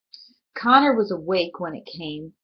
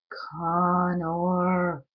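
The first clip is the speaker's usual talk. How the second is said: monster calling. Acted. The monster calling Connor from the dark, drawn out. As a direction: call his name like something vast outside.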